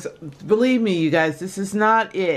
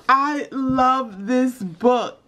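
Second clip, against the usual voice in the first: ordinary talk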